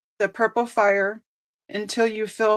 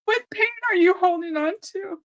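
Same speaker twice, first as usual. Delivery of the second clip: choked up; 8 semitones higher than usual. Real, not acted. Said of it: "I'm gonna start crying".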